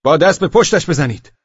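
high, loud urgent cry; shouted. A narrator's reading. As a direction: call it out as an urgent order. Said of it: "یکی فریاد کشید". (با فریاد)

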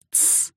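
soft hissed shushing sound; sharp shush. A narrator's reading. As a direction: hiss softly to silence someone. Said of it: "шикнул он". (тихое шиканье)

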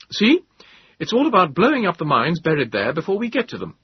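put-on loud, high, eager young officer; clipped officer. A narrator's reading. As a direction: explain it briskly like an officer. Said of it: "In clipped tones".

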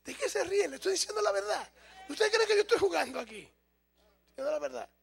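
high, half-joking outrage at the laughter; mock indignant protest. Real, not acted. Preacher scolding laughing congregation, high mock indignation. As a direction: pitch way up, scolding the laughing crowd.

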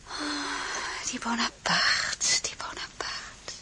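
tired unvoiced whisper, slowing at the end; weary whisper. Alone in the silent palace, Désirée whispers wearily to herself. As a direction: sigh it out alone in the dark.

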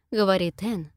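usual speaking voice

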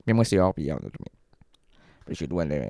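normal talking voice

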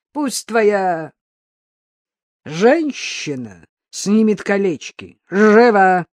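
loud demand ending in a shout; menacing command. A narrator's reading. Mugger demanding the woman's rings, threatening shout. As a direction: order them, then bark the last word.

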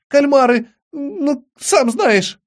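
put-on high, excited boyish outburst; eager guessing. A narrator's reading. Charlie offers Doug his guesses, high and eager.

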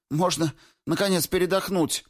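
high-pitched exhausted woman's plea; pleading. A narrator's reading. Weary Alyona begs the squad for rest, pleading. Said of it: "взмолилась Алёна Амельская".

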